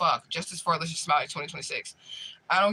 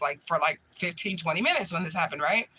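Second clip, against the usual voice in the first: usual speaking voice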